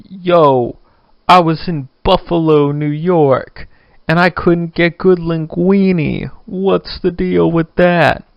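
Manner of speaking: complaining New Yorker. Acted. Host mocking New Yorkers who dislike places they visit, loud complaining New Yorker. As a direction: do an indignant visitor from New York, stretching the gripe. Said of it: "about people from New York".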